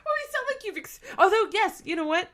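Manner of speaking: jumps up high in surprise, then catches herself; sudden realization. Real, not acted. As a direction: stop short as the thought hits you. Sudden realization mid-sentence.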